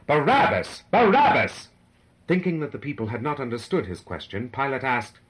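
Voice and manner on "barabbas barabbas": mob chant building louder and higher; swelling cry. A narrator's reading. The crowd cries for the prisoner, louder and louder. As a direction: shout the name, then shout it higher still. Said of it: "Louder and louder swelled the cry".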